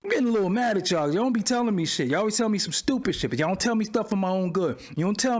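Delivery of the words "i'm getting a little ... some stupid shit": ordinary talk, nothing marked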